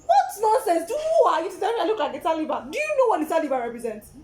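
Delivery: loud, high indignant rant with laughs; angry rant. Retold or imitated. Woman retelling telling off rude guy, loud and high. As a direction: tear into him, half laughing. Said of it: "I was angry".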